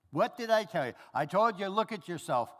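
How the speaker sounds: loud, high scolding rant; mock scolding of a wayward son; scolding parent. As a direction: chew him out like an exasperated parent. Father scolding son, voiced.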